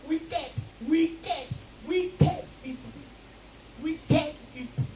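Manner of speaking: emphatic sermon delivery; preaching. Acted. Preacher warning the church about wicked people. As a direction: drive each word home. Preacher stressing his point.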